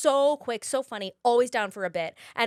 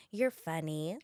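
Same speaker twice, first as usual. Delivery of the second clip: much softer, offhand remark; breezy soft compliment; 5 semitones lower, 7 dB quieter than usual. Retold or imitated. Jennifer Coolidge praising the young actor, soft breezy compliment. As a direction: say it dreamily, throwaway. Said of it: "she was like".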